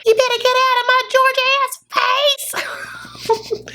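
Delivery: mock screaming, very high strained pitch; Angela. Recapper imitating a woman screaming in his face, high mock screech. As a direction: screech it as an exaggerated impression. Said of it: "her just screaming in his face".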